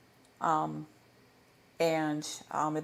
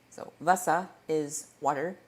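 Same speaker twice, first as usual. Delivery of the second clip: usual speaking voice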